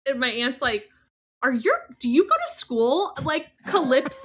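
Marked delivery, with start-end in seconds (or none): 1.4-4.2 loud, high-pitched disbelief; incredulous aunt. Retold or imitated. Aunt to niece who misread a sign, loudly incredulous. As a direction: imitate an appalled aunt, voice shooting up.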